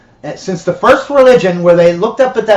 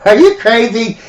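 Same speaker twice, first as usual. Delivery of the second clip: higher, incredulous outburst with a laugh; laughing disbelief; 6 semitones higher, 3 dB louder than usual. Acted. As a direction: scoff through a chuckle. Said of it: "starts laughing".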